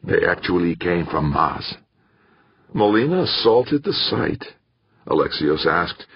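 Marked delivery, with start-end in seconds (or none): 2.7-4.5 high-pitched incredulous question; put-on surprise, voice pitched up; feigned astonishment. A narrator's reading. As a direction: act astonished at the news. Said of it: "trying to look astonished".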